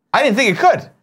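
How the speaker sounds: high excited Gus voice; Gus impression. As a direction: pitch up and play Gus. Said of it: "your best Gus impression".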